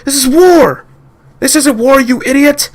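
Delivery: high, loud furious exchange; angry shouting. Acted. Soldier and captain arguing: high furious shout. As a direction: hurl it back at him. Shouting match after shooting.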